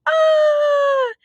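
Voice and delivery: high loud scream; terrified scream. Acted. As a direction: scream for your life. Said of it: "The dogs screamed for their lives".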